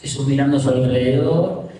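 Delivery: ordinary talk, nothing marked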